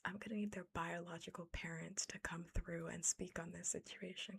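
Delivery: usual speaking voice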